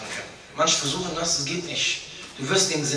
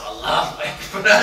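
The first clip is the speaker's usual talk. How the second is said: mock resigned groan; slower than usual. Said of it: "Ich so".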